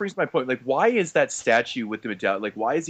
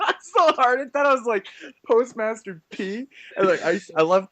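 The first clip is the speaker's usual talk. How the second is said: high, giggly mocking voice; laughing through the name; 11 semitones higher than usual. Real, not acted.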